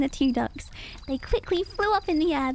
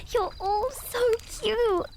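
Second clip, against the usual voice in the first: high, slow, gushing praise; joyful; 8 semitones higher, slower than usual. A narrator's reading. Mama Duck admiring her ducklings, slow delighted gush. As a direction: coo over them with delight. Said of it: "cried Mama Duck with joy".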